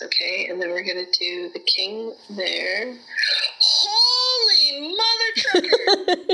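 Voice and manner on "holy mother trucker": high, slow, wailing shriek; screaming crying fan. Real, not acted. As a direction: shriek it out in laughing despair. Raid goes wrong, shrieking.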